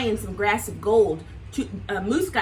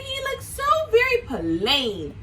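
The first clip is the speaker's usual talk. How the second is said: puzzled Lion; 9 semitones higher than usual. Acted. Lion to friends, puzzled and high. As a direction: wonder aloud in a lively lion voice. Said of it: "Lion said".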